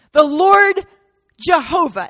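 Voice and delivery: high, loud naming of the Lord; preacher proclaiming God's name high and loud; ringing proclamation. Acted. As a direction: call out the Lord's name.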